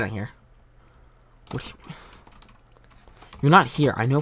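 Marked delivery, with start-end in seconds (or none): none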